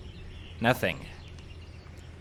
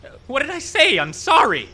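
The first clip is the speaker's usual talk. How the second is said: loud, high, rattled apology; panicked apology; 14 semitones higher, 8 dB louder than usual. Real, not acted. Shaken man apologizing to the owl that swooped, panicked. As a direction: blurt it out, shaken.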